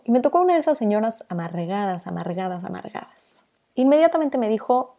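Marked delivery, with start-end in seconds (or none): 2.1-2.6 softer echo of amargadas; fading repeat. Retold or imitated. Echoing bitter old lady, trailing off. 2.6-3.5 amargadas whispered, breath only; whispered echo. Retold or imitated. Recalling bitter pharmacist, whispered echo.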